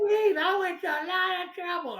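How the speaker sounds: high, loud imitation of her outrage; hammed-up high-pitched complaint; mock indignant. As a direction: act out an exasperated phone scolding. Imitates upset doctor phoning him.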